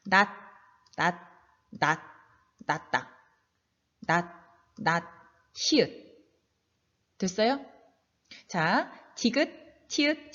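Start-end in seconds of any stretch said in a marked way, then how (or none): 0.1-5.0 낫 cut off as nat, tongue stopping the t; short, separated 낫, ending in a stopped t, no s; final ㄷ demonstration. Shown as a demonstration. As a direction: model each 낫 separately with an unreleased t. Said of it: "디귿으로 발음해요".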